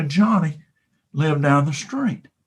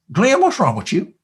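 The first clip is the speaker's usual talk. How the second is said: talking cow; 7 semitones higher, 3 dB louder than usual. Acted. Cow Bessie speaks.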